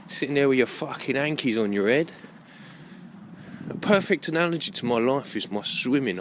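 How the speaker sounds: ordinary delivery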